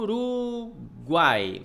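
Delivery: URUGUAI stretched slowly, sound by sound; drawn-out syllables. Shown as a demonstration. Teacher showing the vowels in Uruguai, drawn out slowly. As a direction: draw out "Uruguai" syllable by syllable, long first U. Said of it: "Você tem o U".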